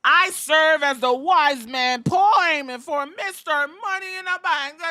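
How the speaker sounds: high, loud announcer-style Heyman impression; pompous mock Heyman announcing himself; mock Paul Heyman intro. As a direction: ham up the wise man introduction. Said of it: "Ladies and gentlemen".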